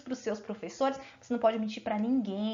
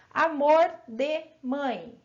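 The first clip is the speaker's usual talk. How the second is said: amor de mãe slow and drawn-out; slow demo; 3 dB louder, much slower than usual. Shown as a demonstration. Teacher showing pupils the phrase, slow and drawn-out. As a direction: stretch out amor de mãe for the class. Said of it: "se eu falar assim".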